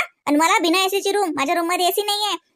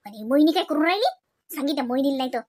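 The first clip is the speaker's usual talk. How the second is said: ordinary talk, nothing marked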